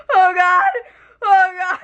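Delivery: high pained mock whimper; whimpering oh God in pity. Imitating herself outside the bathroom.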